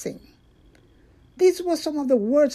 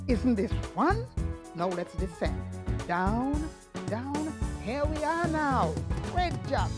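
put-on gentle host reading to children; storytime voice; 7 dB quieter than usual. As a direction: invite little kids along, gently. Storytime host intro with music.

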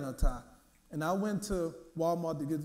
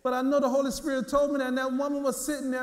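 rising preaching fervor; 6 semitones higher, 5 dB louder than usual. Acted. Preaching builds to full force.

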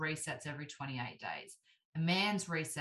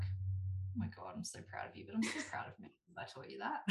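hushed, laughing delight; giggly whisper; 5 semitones higher, 7 dB quieter than usual. Retold or imitated. Praising her husband for remembering, in a giggly whisper. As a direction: whisper it, grinning with pride.

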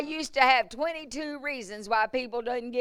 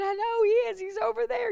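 much higher, pleading insistence; whiny protest; faster than usual. Retold or imitated. Preacher retelling her flustered answer to God, much higher. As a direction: plead high like a flustered child.

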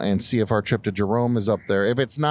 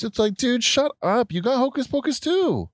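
exasperated; 13 semitones higher than usual. Real, not acted. Heated rant at critics.

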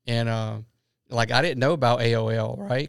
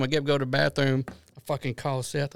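ordinary delivery

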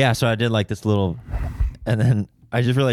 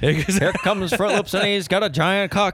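high-pitched joke race commentary; race announcer; 9 semitones higher than usual. Pretend. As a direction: parody a horse-race caller, pitched well up. Joins the mock race call.

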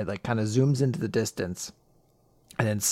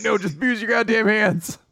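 raised, impatient acted outburst; mock exasperated retort; 7 semitones higher than usual. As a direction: scold her in mock exasperation. Retort to dainty protest.

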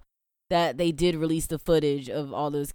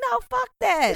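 high-pitched, hammed-up refusal; retold exasperated refusal. Replaying their reply to co-host's questions, mock exasperation. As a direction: pitch it way up, playfully fed up. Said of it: "And I'm like".

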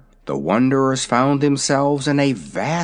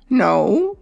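high, proud little dragon; young dragon voice; 9 semitones higher than usual. Acted. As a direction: talk like a vain baby dragon. Dragonette answers.

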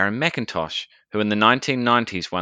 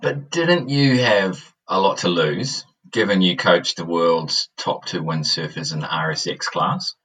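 cave echo; 4 dB louder than usual. Real, not acted. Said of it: "I sound like I'm in a cave".

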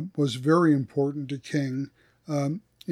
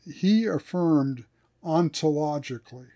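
normal talking voice

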